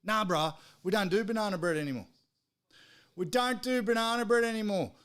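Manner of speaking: mock the drive-through kid, high and dismissive; mocking the bloke. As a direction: sneer it out like a bored teen worker. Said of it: "Talks to me like this".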